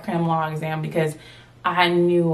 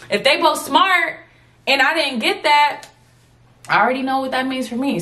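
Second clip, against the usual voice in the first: high distraught; 6 semitones higher than usual. Retold or imitated. Student recalls panic over gunners' answers, high and tearful. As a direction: spill it out like you just failed. Retelling despair over exam.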